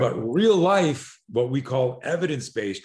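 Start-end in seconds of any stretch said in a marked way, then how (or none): 0.0-1.2 real life, drawn out slowly and louder; slow emphasis on real life. Real, not acted. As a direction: land hard on real life. Emphatic contrast.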